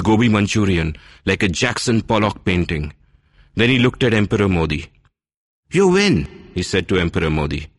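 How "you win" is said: high resigned concession. Acted. Envoy conceding.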